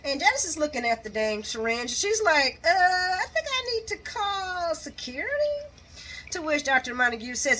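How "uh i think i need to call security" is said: mock-nervous, raised pitch; hesitant alarm. Nurse eyeing the syringe, mock hesitant alarm. Janice hesitantly answers in a retold scene.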